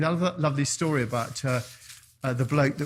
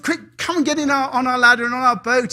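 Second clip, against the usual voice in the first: higher, louder hurried urging; urgent call; 7 semitones higher, 7 dB louder, faster than usual. Acted. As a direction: rush him onto the ladder. Fire brigade urging rescue.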